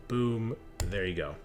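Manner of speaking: ordinary delivery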